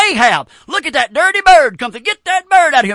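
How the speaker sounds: Jezebel scolding. Retold or imitated. Jezebel orders Ahab to chase the bird off, lightly voiced scolding. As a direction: Jezebel snapping at her husband, lightly voiced. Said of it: "She says".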